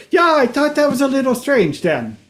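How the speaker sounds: high-pitched mock Swiss accent; caricatured Swiss tourist voice. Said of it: "we are from the Switzerland".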